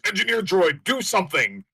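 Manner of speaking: loud, high-pitched captain's shout; shouted panicked command; urgent yell. Acted. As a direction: yell an order at the engineer in a crisis. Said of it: "so Scar just yells".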